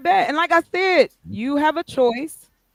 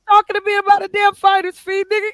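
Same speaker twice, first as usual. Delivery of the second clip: raised, higher protest; loud; 9 semitones higher, 5 dB louder than usual. Real, not acted. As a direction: call him out, loud. Heated accusation among friends.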